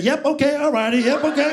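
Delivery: put-on high-pitched clueless kid, rising endings; helpless, chirpy fast-food worker impression; Mike. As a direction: play the kid caught in the middle. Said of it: "He's doing this shit".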